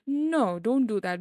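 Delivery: ordinary talk, nothing marked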